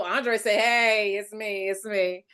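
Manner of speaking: ordinary talk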